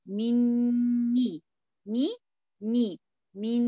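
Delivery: "مني، مي، ني" dragged very slowly, noon held; teacher drills "مني" syllable by syllable; slow ghunna drill. Shown as a demonstration. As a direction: break "مني" apart slowly. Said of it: "يبقى تاني".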